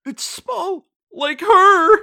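mock crying parent at art show; mock sobbing. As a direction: blubber it sentimentally.

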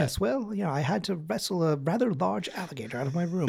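posh English butler. Pretend. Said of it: "being like".

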